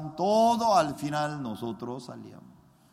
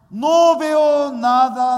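loud, high and very slow cry; anguished prayer; 10 semitones higher, 13 dB louder, much slower than usual. Retold or imitated. The pastor crying out to God, slow anguished loud plea. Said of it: "Yo le preguntaba a Dios".

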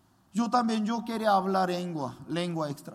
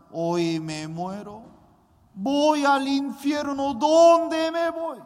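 slow, rising, louder anguished cry; despairing wail; 5 semitones higher, 5 dB louder, slower than usual. Retold or imitated. Teenager weeping alone in the street, despairing wail. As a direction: let the despair swell.

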